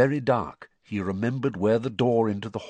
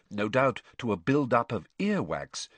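usual speaking voice

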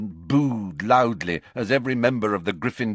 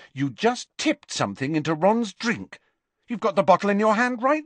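put-on higher young girl, rising indignation; accusing girl; 9 semitones higher than usual. A narrator's reading. Hermione confronting Harry over the drink, indignant. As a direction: catch him in the act.

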